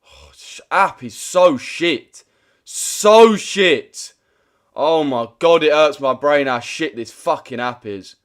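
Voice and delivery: slow, loud, dragged-out disgust; real fed-up rant. Real, not acted. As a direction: lean hard into "so". Ranting at the app.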